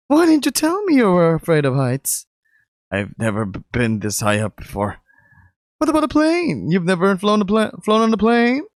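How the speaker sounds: put-on high, upbeat young man; bright excited tour guide boyfriend; lifted pitch, cheerful guy; Mark's voice. Mark voiced again.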